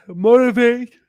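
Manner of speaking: word swallowed by a loud, high yawn. Real, not acted. Tired streamer to viewers, yawning. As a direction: push the word through a yawn. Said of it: "excuse me".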